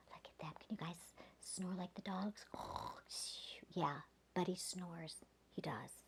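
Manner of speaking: soft hushed whisper; quiet bedtime whisper. Acted. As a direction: keep it to a whisper so the dogs sleep. Whispering about the dogs snoring.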